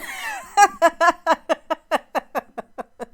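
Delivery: a long loud laughing fit. Real, not acted. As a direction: keep laughing as you warn. Laughs telling mom guard son.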